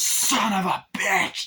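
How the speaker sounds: high, loud drawn-out swearing; mock angry curse. [name] at Britney's overtaking, mock furious curse. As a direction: curse another driver in a put-on voice.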